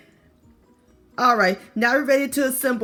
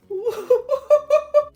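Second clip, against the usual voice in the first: high giggle; 5 dB louder than usual. Real, not acted. Laughing at melted cheese.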